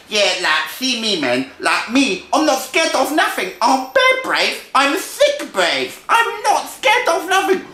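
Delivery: high, loud street-slang little mouse; cocky little brother. Acted. Little brother brags to big brother, high and cocky. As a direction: boast in a climbing, excitable squeal. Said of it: "And the little brother said".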